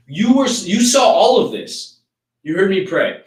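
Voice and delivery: a man in heaven, furious at God, shouting up at him; angry outburst. Retold or imitated. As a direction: yell the accusation at God, slow and loud. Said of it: "He's pissed".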